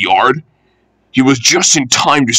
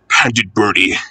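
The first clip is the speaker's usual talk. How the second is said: usual speaking voice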